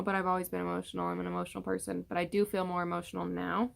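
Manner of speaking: usual speaking voice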